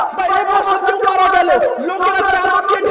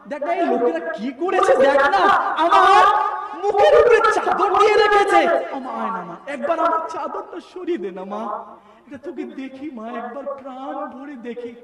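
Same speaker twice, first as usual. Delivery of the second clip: fading to a hushed, tearful plea; a dead son's fading lament, much softer; mournful plea to mother; 5 semitones lower, 4 dB quieter than usual. Acted. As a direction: beg your mother softly, voice sinking away. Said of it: "মুর্দা চিৎকার করে বলে".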